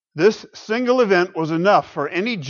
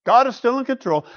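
higher, resigned throwaway; mock defeatist shrug; 10 semitones higher, 6 dB louder than usual. Preacher mocking a defeatist believer, with a resigned shrug. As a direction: sound like someone giving up. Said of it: "defeatist resignation".

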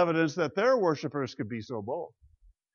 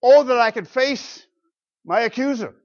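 raised, louder plea with bold emphasis; Job; 6 semitones higher, 6 dB louder than usual. Acted. Job longing to face his accuser, bold raised lament. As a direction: cry out the challenge in a high, strong voice. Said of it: "Job talks like this".

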